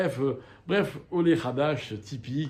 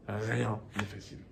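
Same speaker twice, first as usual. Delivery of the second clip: softer, lower repeat, letting it sink in; quiet echo; 5 semitones lower, 8 dB quieter than usual. Shown as a demonstration. Teacher repeats the lesson to new immigrants, softly. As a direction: say it again under the breath.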